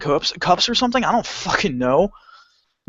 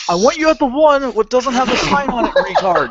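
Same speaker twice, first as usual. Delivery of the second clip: exasperated retort; 9 semitones higher, 4 dB louder than usual. Retold or imitated. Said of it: "I'm just like".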